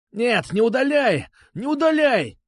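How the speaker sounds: high drawn-out desperate cry; shouting. A narrator's reading. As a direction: plead loudly, pitch climbing. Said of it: "кричал Бубен". (отчаянно кричать)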